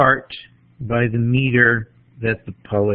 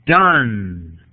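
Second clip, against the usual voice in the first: DONE stretched long with a falling tune; stressed syllable drawn out. Shown as a demonstration. Lecturer scans the line for stresses, "Done" drawn out and falling. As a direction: hold "Done" long and let it fall away.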